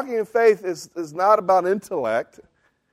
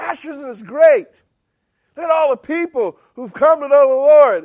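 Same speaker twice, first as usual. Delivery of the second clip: high, eager gushing; excited young believer; 8 semitones higher, 6 dB louder than usual. Retold or imitated. Excited young believer going up to his group leader, gushing. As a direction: gush it out, pitched up and louder. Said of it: "and I was excited".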